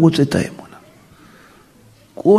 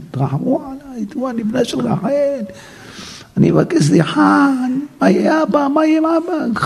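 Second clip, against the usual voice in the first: high, softer pleading, half sung; pleading cry; 11 semitones higher, 3 dB quieter than usual. Acted. Joseph begging brothers from the pit, high pleading. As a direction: beg from the pit, near tears. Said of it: "היה בוכה להם".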